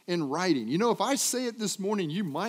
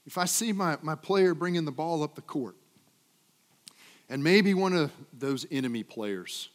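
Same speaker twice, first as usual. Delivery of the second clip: ordinary talk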